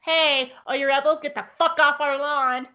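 high-pitched mock warning to intruders; get-off-my-lawn holler. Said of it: "They are just like".

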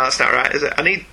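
normal talking voice

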